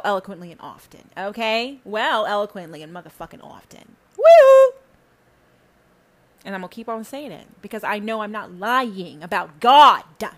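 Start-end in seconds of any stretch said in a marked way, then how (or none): 4.2-4.7 high, loud celebratory "woo"; triumphant whoop. Real, not acted. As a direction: whoop like you nailed it. Triumphant shout after her point.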